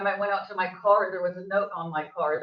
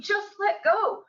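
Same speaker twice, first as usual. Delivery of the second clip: pleading; 10 semitones higher, slower than usual. Retold or imitated. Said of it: "the whole time we're going".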